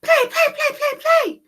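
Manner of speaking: very high, loud eager chant; excited gosling. Acted. Gosling begging Jack to play. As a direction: bounce with excitement. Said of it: "cried the excited baby goose".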